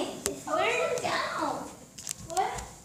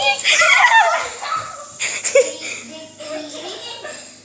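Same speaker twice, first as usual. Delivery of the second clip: loud burst of laughter; 3 semitones higher, 8 dB louder than usual. Real, not acted. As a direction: crack up loudly. Bird flies loose in house.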